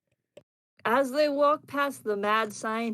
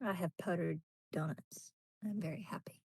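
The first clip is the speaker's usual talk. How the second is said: whispering; 4 semitones lower, 11 dB quieter, faster than usual. Real, not acted. Quiet aside about donuts.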